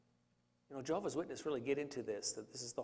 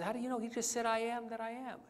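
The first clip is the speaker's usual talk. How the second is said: raised, higher quoted voice; answering back in a higher voice; 8 semitones higher, faster than usual. Retold or imitated.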